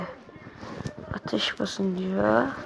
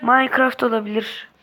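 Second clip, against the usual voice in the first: normal talking voice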